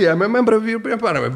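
quick, high mock protest; lighter, hurried replay of a yell; retold shouting. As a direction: rattle off the cry fast and high. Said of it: "di me che grido".

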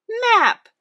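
very high, loud call. A narrator's reading. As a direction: shout the word like summoning a friend. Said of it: "called Dora and Boots".

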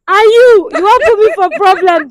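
loud, emphatic telling-off; loud scolding. Real, not acted. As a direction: scold loudly in happy shock. Girlfriend playfully scolds the host.

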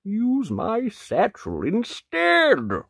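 slow, high gentle wolf. Acted. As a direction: kindly offer it slowly. Wolf suggests.

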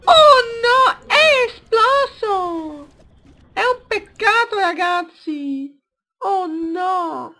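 very high, slow fake wail; squeaky mock lament. Enemy explodes, mock lament.

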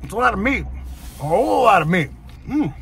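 chewing while talking; muffled by food in mouth; mouth full. Real, not acted. Seen 0: Bite at -3.0; closed, working lips through most labelled frames.

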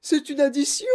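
halting, high, loud cry; squeaking, alarmed pupil; panicked exclamation. A narrator's reading. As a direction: stumble into a frightened protest. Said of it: "s'écria Guillaume".

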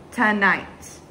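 ordinary delivery, nothing marked

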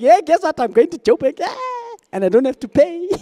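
mock excited worker telling the family; excited bragging. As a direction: show off the free trip like a thrilled employee. Worker bragging about the trip.

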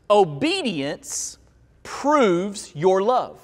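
spaced out slowly for weight; slow, measured key point; slow, deliberate emphasis. Real, not acted. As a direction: let each word land, pausing midway. Preacher slows for key point.

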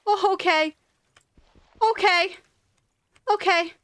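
high, loud, startled okays; shaken from a scare. Real, not acted. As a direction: squeak it out, recovering from a fright. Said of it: "That actually scared me".

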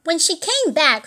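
plain reading voice

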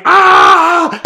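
high, loud mock scream of pain; imitated scream. Retelling Paco's reaction to rubbing alcohol, high mock scream. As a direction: yelp like a kid hit by alcohol sting. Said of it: "He starts screaming".

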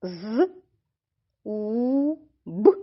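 З, у, б as three separate sounds; sounding out. Shown as a demonstration. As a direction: buzz З, hold у, pop б. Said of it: "Рахуємо".

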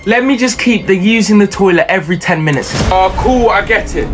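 normal talking voice

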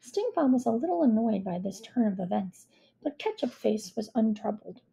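ordinary delivery, nothing marked

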